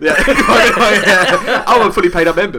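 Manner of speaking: high and loud through laughter; laughing. Real, not acted. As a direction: laugh while claiming membership. Joking he joined the church.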